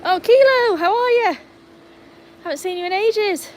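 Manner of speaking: high, loud, cooing greeting to the dog; high pet talk. Real, not acted. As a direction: gush over the dog.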